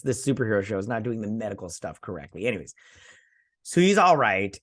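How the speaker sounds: normal talking voice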